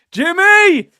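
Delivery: big high yell of the name; shout. Real, not acted. Streamer yelling at James over the faked sick call. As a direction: bellow his name in mock outrage.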